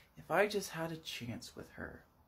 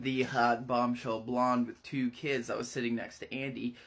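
ordinary talk, nothing marked

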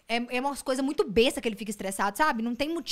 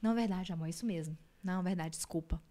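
soft, lower, placating voice; imitated apologetic husband; 5 semitones lower, 8 dB quieter than usual. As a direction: give in gently, imitating the husband. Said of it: "eu vou falar um negócio e ele". (marido pedindo desculpa baixinho)

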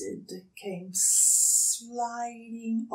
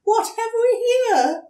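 much higher, loud fluttering question; fluttery emu; 9 dB louder than usual. Acted. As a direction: flutter it out daintily. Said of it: "she fluttered".

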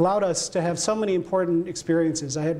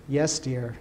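low, understated exasperated reply; mock exasperation; 5 semitones lower than usual. Husband imitates his wife answering him, mildly exasperated. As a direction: imitate a wearily patient spouse, pitched down. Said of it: "sideways with exasperation and say".

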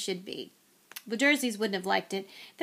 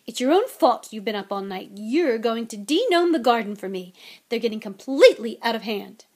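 loud, firm motherly telling-off; snapped scolding; 8 dB louder than usual. Acted. Mother ordering her tired son to the garden, scolding. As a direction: lay down the chore loudly and crossly. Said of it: "snapped Missus Weasley".

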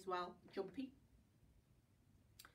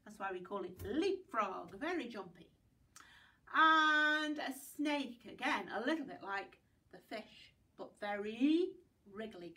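ordinary talk

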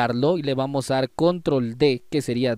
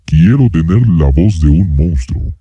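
far lower, pitch-shifted monster voice; monster voice effect; 10 semitones lower, 12 dB louder than usual. Shown as a demonstration. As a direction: make it sound like a monster. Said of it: "Ya más o menos está sonando a monstruo".

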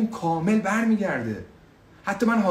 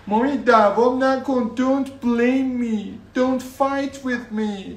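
a lightly voiced child, higher and slow in English; 6 semitones higher, much slower than usual. A hint of a child asking mommy for food and water. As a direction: play the little kid calling mommy.

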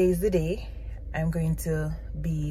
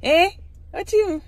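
high, loud playful questions; 7 semitones higher, 4 dB louder than usual. Real, not acted. Mother quizzing her baby in the car, high and loud.

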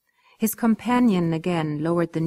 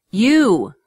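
loud, pointed call of you; hammed-up loud Englishman talking down to a foreigner; loud slow You; 4 semitones higher, 9 dB louder than usual. A narrator's reading. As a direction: bark it at her, slow and loud. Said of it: "spoke slowly and loudly".

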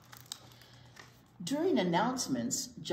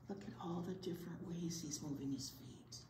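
soft awed whisper; 10 dB quieter than usual. Real, not acted. Teacher pointing out John's dancing to kids, awed whisper. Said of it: "whispered aside to kids".

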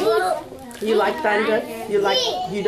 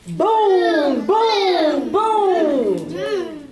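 thunder booms; 7 semitones higher, much slower than usual. Shown as a demonstration. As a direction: lead the children in booming. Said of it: "can you say boom".

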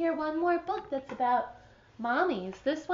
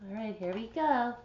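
ordinary delivery, nothing marked

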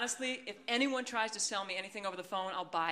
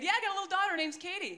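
high, chirpy fake-mum voice; mock proud mother; 11 semitones higher, faster than usual. Caller pretending she has a daughter, mock chirpy. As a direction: put on a bubbly mummy pitch.

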